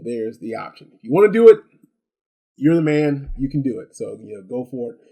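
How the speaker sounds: ordinary delivery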